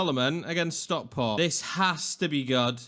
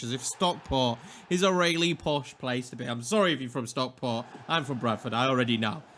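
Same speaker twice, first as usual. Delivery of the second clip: ordinary delivery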